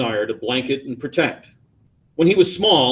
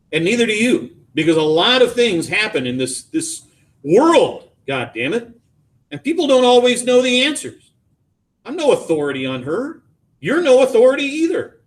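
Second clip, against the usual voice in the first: heated, higher-pitched outburst; angry; 11 semitones higher, 5 dB louder than usual. A narrator's reading. Father lashes out at son about his absent wife, angry. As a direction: hit back hard, pitch jumping on key words. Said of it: "the anger recedes".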